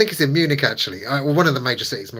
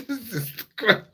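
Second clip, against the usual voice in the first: mock delighted quote; 11 semitones higher than usual. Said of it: "I was just like".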